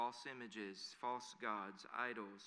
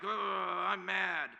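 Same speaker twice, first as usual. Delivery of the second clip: loud, high groan of "I'm mad"; hammed-up angry grumble, shouted; grumbling; 6 semitones higher, 11 dB louder, much slower than usual. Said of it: "to us, grumbling's like".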